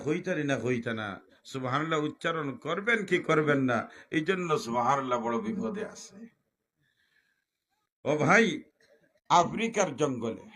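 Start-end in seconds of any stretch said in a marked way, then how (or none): none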